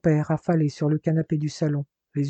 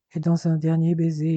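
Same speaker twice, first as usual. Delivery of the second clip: ordinary delivery, nothing marked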